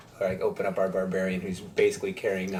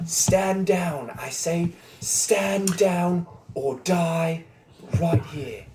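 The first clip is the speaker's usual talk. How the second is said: commanding yell; 9 semitones higher, much slower than usual. Acted. Slamming down a staff before the devil, yelled command. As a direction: draw out each word as an order.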